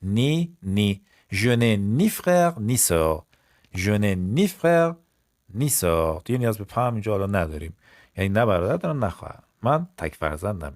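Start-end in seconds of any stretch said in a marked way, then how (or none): 0.0-1.0 نی said clearly twice, set apart; stressed ni. Shown as a demonstration. Teacher to class, pointing out ni. 1.3-6.2 ni frère ni sœur slow, each ni marked; slow model stressing ni. Shown as a demonstration. Teacher to class, slow stressed ni. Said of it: "slow model stressing ni".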